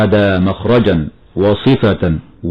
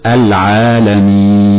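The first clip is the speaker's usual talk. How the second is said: slow chanted العالمين with a clear lam; tajweed recitation; slower than usual. Shown as a demonstration. Sheikh showing the clear lam to learners, slow chant. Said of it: "فنقول".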